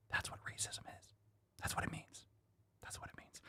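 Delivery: breathy, hushed aside; whisper. Pretend. Vlogger whispering a sarcastic aside to viewers. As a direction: lean in and whisper it. Mock aside about racism.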